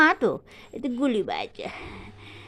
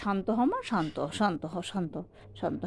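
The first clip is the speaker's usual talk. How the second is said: soothing hush; 4 semitones lower, 7 dB quieter than usual. Real, not acted. Parent calming a struggling toddler.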